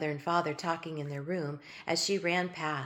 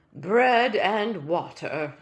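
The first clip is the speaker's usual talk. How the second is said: mournful; 8 dB louder, much slower than usual. Acted. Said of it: "he said mournfully".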